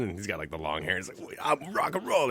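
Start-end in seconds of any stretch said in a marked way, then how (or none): 1.3-2.3 rock star attitude. Retold or imitated. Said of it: "he's got that whole attitude".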